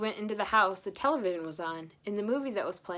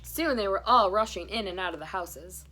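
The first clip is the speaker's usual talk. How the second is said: usual speaking voice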